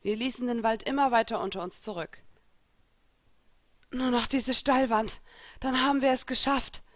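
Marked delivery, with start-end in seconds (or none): 3.9-6.8 out of breath from the climb, a bit higher; breathless call from the rock face; panting. Acted. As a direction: gasp it out between breaths. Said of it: "keuchte Elsa".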